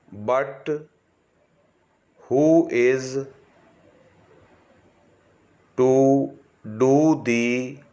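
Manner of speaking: slow word-by-word dictation with long pauses; slow school dictation. Shown as a demonstration. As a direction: dictate each word slowly for shorthand students.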